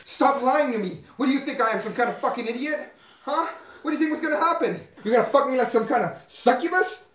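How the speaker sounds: high, heated, loud tirade; furious accusation. Acted. Man accusing Carol of lying to him, furious.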